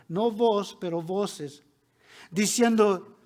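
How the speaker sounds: ordinary talk, nothing marked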